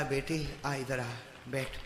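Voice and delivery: low, very soft voicing of a father; quiet, low acted deathbed words; hushed dying father. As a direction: gently call the daughter close. Dying father calls daughter over, softly.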